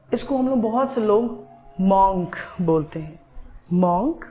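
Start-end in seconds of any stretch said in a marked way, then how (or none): 1.8-2.4 मॉन्क with an open "aw" vowel; wrong pronunciation. Shown as a demonstration. Teacher quoting what many people say, wrong "aw" in मॉन्क. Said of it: "ये सही नहीं है". 3.7-4.2 मॉन्क with an open "o" vowel, rising; wrong pronunciation. Shown as a demonstration. Teacher querying the common mistake, wrong "aw" in मॉन्क. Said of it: "ये सही नहीं है".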